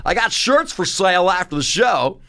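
hammed-up huckster selling to the crowd; mocking hack comic. Hack college comic plugging merch after a show, mocking stage voice. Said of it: "You do that impression 'cause you do it well".

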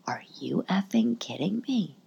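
hissed whisper of disbelief; incredulous whisper. Retold or imitated. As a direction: whisper it, outraged but hushed. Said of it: "And I'm just like".

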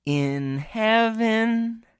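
very slow, high sung squirrel voice. Pretend. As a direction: croon it like a squirrel singing. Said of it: "I practiced my squirrel impression".

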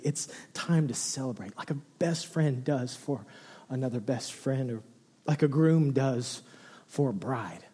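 soft, hushed, partly whispered reassurance; God's tender voice. Retold or imitated. God reassures repentant people, softly and tenderly. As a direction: whisper it gently, like comforting a friend. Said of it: "And God goes".

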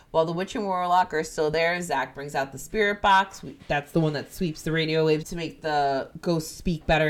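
usual speaking voice

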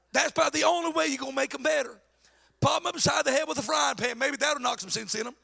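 ordinary delivery

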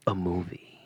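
hushed spooky whisper. Acted. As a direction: whisper it like a secret.

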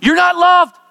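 high, loud accusing shout; devil's shout. Acted. The devil shouts his lie at the praying believer. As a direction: hurl the lie at them.

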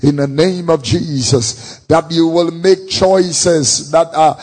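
loud rising prayer call; fervent prayer. Acted. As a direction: lead the crowd in prayer. Preaching prayer at full force.

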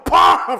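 loud, explosive "pah" gunshot noise; imitated gunshot. Acting out a shot from behind, imitated gunshot. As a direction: fire off a high, loud bang.

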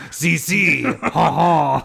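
hammed-up French cartoon voice, laughing shout on ha ha; a bad Pepe Le Pew French accent; jokey fake French accent with a shouted ha ha; awful French accent. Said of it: "That's an awful accent".

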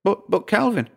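high-pitched stammered protest. A narrator's reading. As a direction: object timidly in a high woman's voice. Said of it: "Dora protested".

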